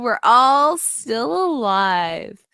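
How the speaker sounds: ordinary talk, nothing marked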